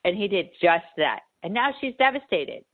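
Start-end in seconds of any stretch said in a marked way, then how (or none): none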